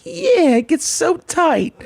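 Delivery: high mock whine; mocking a friend's pathetic complaint; whiny friend imitation. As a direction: stretch it out like a sulky kid. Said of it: "He's like".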